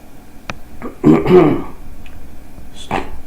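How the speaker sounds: sharp involuntary hiccup; a real hiccup jolting out. Real, not acted. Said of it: "I've been hiccuping nonstop".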